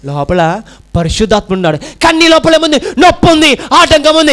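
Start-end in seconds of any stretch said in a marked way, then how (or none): 1.8-2.8 shouted, high-pitched sermon lines; full-force preaching. Acted. Preaching climax. 3.0-4.3 నొప్పి and ఆటంకం hit high and loud; pressed hard. Retold or imitated. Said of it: "వీటిని మాత్రం ఒత్తి పలుకుతూ".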